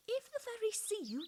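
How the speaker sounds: high, urgent warning. A narrator's reading. Plants warning Mamie about fairies, high urgent voice. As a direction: warn her of the danger. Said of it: "they warned her".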